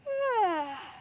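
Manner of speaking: long sigh falling from high; tired sigh. Acted. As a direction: let out a big, weary sigh. About to go nap.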